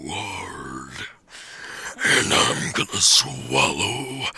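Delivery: low, slow sultry whisper; breathy seductive whisper, drawn out. Acted. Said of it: "say in your lowest, most sultry voice".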